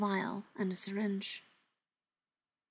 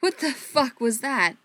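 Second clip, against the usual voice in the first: slow, louder, higher demand; disbelieving demand; 4 semitones higher, 6 dB louder, slower than usual. Acted. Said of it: "Andy demanded".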